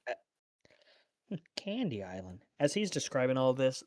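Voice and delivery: ordinary delivery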